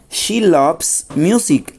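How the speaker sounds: slow, every word spaced out for learners; slow model sentence. Shown as a demonstration. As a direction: read it out slowly, like a teacher dictating. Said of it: "noten que el verbo está llevando S".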